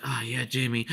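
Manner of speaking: soft, breathy mocking coo; mock swoony sigh. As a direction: coo his name like a satisfied lover. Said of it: "wipe his lips like".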